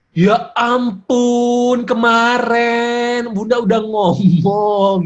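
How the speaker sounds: high, loud, very drawn-out mock wail; exasperated mother. Shown as a demonstration. Mother scolding child next day, hammed-up exasperation. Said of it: "Daripada apa? Besoknya kita ngomongin lagi".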